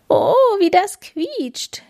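hohe, langsame Vogeldamenstimme; rufende Amseldamen. A narrator's reading. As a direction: wie aufgeregte Amselfrauen rufen. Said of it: "riefen die Amseldamen". (hohe Amseldamen-Stimme)

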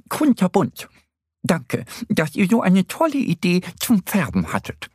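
high, bouncy hare voice; grateful hare. A narrator's reading. Easter Bunny thanking both friends, delighted. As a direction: thank them in a high character voice.

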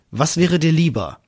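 normal talking voice

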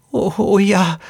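put-on high, pleading child voice; insistent little girl. A narrator's reading. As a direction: insist like a scared small girl. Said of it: "beharrte das Mädchen". (hohe, beharrliche Kleinmädchenstimme)

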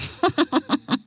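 high gleeful villain cackle; villainous laugh. Acted. Aisha gloating over her plan, high cackle. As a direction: cackle like a lioness pleased with herself.

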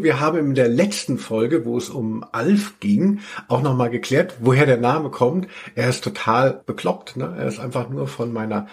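ordinary talk

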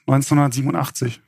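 normal talking voice

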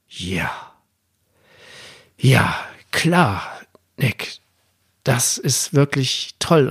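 halting, long pauses between words; slowly conceding, as if it just sank in; dawning realization. Retold or imitated. Father struck by his son's insight. (zögernd, nachdenklich einsehend)

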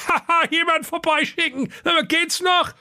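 very high mocking brothers, laughing; mocking laughter. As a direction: ridicule the stranded caller. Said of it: "lachten nur und äfften den armen Mann nach".

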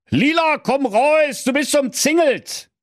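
calling out. A narrator's reading. Wolf orders Lila out of her house, calling out. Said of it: "da rief er".

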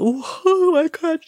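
usual speaking voice

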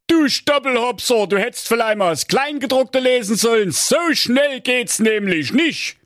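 high, squawky scolding king. A narrator's reading. King snapping at Schnitzelschorsch in a high scolding voice. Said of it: "riss ihm das Papier aus den Händen und rief".

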